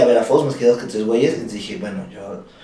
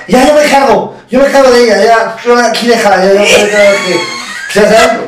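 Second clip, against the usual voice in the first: flustered insisting; 8 semitones higher, 4 dB louder than usual. Retold or imitated. Acts flustered handover of drunk friend.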